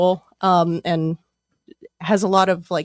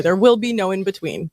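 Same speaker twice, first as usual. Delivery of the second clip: ordinary talk, nothing marked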